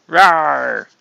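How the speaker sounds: loud mock monster "rawr"; playful roar. Playful roar for Ghost Rider.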